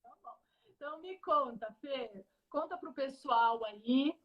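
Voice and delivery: glitchy, fading internet audio; connection breaking up. Real, not acted. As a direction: audio dropping out mid-sentence. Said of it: "Falou que o áudio tá travando". (conexão travando)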